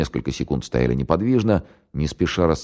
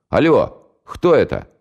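gruff phone greeting; 9 semitones higher, 5 dB louder, slower than usual. Acted. Egor answers posing as Stepan.

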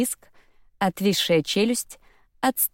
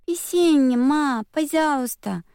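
put-on high, squeaky, slow begging child; squeaky toddler; 9 semitones higher, slower than usual. A narrator's reading. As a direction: whine like a little girl. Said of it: "сказала она писклявым голоском".

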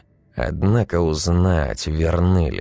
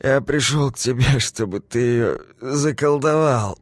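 put-on drunk rambling; 10 semitones higher than usual. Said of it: "бубнил мужчина".

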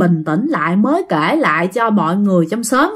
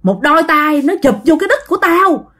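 higher-pitched, emphatic retold testimony; pleading insistence in a raised pitch; insisting; 6 semitones higher than usual. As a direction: insist on what happened to you, voice pitched up. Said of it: "bà Út khẳng định rằng á là".

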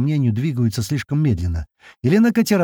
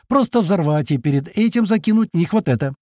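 agitated, higher-pitched insistence; worked-up explanation; flaring up; 6 semitones higher than usual. A narrator's reading. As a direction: insist heatedly on the plan. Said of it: "взвился Гера".

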